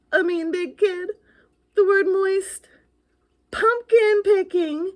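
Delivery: high, loud scoffing questions; hammed-up scorn; mock disbelief. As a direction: scoff at each silly scare. Ghost mocks the tame scares.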